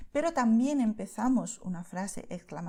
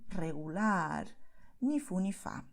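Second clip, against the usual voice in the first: hesitant so-so; 3 semitones lower, 4 dB quieter, slower than usual. Shown as a demonstration. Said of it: "Socialmente preferimos decir".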